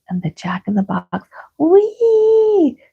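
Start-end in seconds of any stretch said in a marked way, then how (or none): 1.6-2.8 very high, loud whoop; delighted yell. Acted. Small boy whooping with delight, very high. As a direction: whoop for joy.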